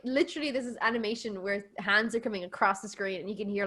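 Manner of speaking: ordinary talk, nothing marked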